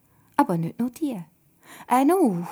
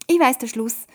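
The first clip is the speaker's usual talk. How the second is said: quick, louder eager blurt; eager call; 5 semitones higher, 9 dB louder than usual. A narrator's reading. Joel eagerly calling out that he knows the ending. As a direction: blurt it out, proud to know. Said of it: "ruft der Joel geschwind".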